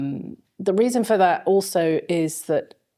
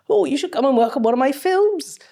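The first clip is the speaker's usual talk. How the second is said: high, loud, hammed-up Welsh accent; terrible Welsh accent; 10 semitones higher, 5 dB louder than usual. An art director inviting the teenager onto his films, in a bad Welsh accent. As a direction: play a booming Welshman in a clumsy Welsh accent. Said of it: "it's my terrible Welsh accent".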